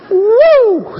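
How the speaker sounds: high, loud rising woo like being whisked off; whooshing woo. Pretend. Preacher showing Philip carried off, loud high whoop. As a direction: swoosh him away with a loud whoop.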